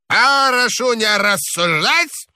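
put-on high, creaky old man's order; an old man shouting through the wall. A narrator's reading. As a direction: bark it like a grumpy old boss. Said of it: "старческий голос".

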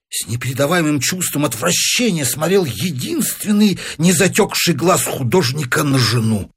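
plain reading voice

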